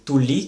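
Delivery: "tulli" with short u and a held l; double L. Shown as a demonstration. Teacher comparing three Finnish words, long l in "tulli". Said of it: "une différence dans la prononciation".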